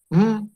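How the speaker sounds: Mm hummed alone, no vowel; bare phoneme m. Shown as a demonstration. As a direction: lips closed, just "Mm". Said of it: "ese es el fonema". (Mm, el fonema solo, sin vocal)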